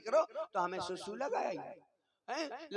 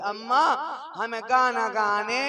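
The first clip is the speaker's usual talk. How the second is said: put-on loud, slow, teary child's plea; crying child; 2 semitones higher, 7 dB louder than usual. Boy begs his mother during the singing, loud and tearful. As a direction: wail it like a desperate little boy.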